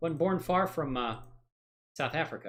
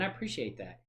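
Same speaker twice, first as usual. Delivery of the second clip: ordinary talk, nothing marked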